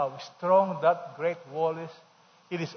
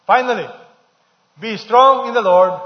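full-force preaching; 5 semitones higher, 12 dB louder than usual. Acted. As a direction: proclaim the verse to the back row.

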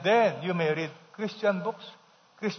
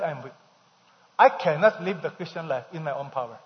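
ordinary talk, nothing marked

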